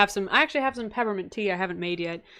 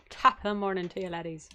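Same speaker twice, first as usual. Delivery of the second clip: understated try at an Irish greeting; tentative Irish accent; 5 dB quieter than usual. Shown as a demonstration. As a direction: test the Irish accent under your breath. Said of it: "eventually do an Irish accent".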